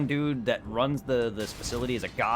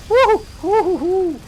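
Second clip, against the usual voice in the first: loud high whoop; cheering; 12 dB louder than usual. Real, not acted. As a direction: whoop with joy. Cheering in game.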